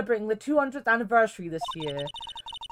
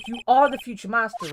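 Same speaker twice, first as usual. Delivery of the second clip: ordinary delivery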